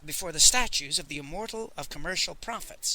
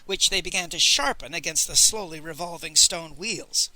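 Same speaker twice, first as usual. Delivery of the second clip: ordinary delivery, nothing marked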